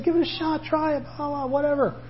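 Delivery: high, soft coaxing voice; light coaxing. Acted. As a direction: toss out an airy challenge, high and soft. Voices a challenge to hesitant listeners.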